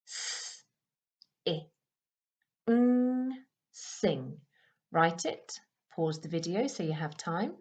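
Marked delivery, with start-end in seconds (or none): 0.1-4.4 S, I, ng, then blend sing; sounding out words. Shown as a demonstration. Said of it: "special friend ng".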